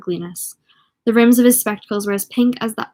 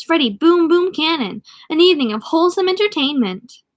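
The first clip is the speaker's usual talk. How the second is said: announcer read; 7 semitones higher than usual. Acted. Marquee announcing the rock and roll show, hammy announcer voice. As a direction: sell the rock show like a radio ad.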